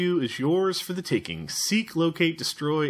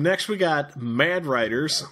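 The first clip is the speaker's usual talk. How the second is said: ordinary delivery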